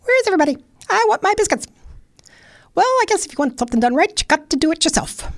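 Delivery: very high, squeaky, quick squirrel. Acted. Hungry squirrel looking for his biscuits, squeaky and high. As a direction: chatter like an impatient squirrel. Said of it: "the squirrel ... going".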